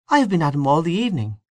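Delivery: high, quick character voice; complaining female character. A narrator's reading. Miss O'Callaghan on coaxing the singer, high quick complaint. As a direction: rattle it off high-pitched.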